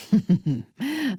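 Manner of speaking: a falling, amused laugh; a short knowing laugh; dark chuckle. Acted. A dark elf chuckling at a companion's choice.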